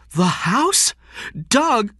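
high, loud, alarmed protest; shocked. Acted. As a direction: cry out to your husband in disbelief. Wife learns house is at stake.

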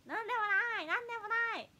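high, loud idol catchphrase from an adult; grown woman doing idol lines. Pretend. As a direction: chirp it like an idol trying too hard. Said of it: "すごい大人の女性が".

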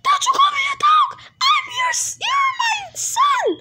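very high, fast shriek; squeaky scolding. Acted. Dad scolding son over dog in a very high shriek.

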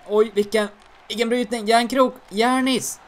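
high, loud thrilled outcry; excited shout. Real, not acted. Gamer cheering a teammate's big hit.

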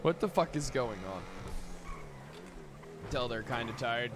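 usual speaking voice

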